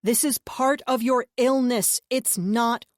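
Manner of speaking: loud, slow pleading reassurance; urgent reassurance. A narrator's reading. Narrator urging the brother it isn't real. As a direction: talk him down, firm and slow. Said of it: "I said".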